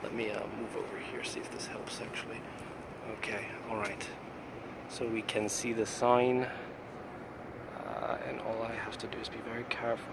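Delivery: soft hushed concentration. Real, not acted. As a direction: talk under the breath, careful. Careful shot, speaking softly.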